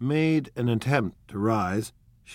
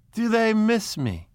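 high, loud, drawn-out delight; thrilled, slow and soaring; ecstatic cry; 12 semitones higher, 5 dB louder than usual. A narrator's reading. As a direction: cry it out in rapture. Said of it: "she cried ecstatically".

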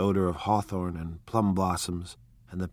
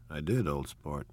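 automatic; 4 semitones lower, 6 dB quieter than usual. A narrator's reading. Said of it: "he said automatically".

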